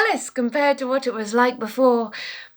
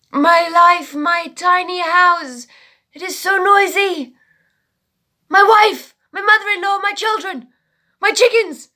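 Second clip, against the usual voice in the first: desperate pleading man; 3 semitones higher than usual. Acted. Exhausted poor man begging his rabbi, high wail. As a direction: plead with the rabbi, near tears.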